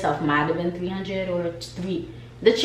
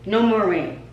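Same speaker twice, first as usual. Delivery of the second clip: normal talking voice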